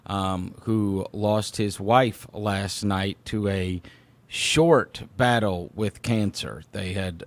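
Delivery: softly spoken, grave news of a loss; somber, subdued. Real, not acted. Host telling listeners of a coworker's loss, somber and hushed. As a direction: break sad news gently and quietly.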